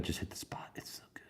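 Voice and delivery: soft satisfied whisper; hushed contented praise. Real, not acted. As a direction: breathe it out after a sip. Whispered praise after a sip.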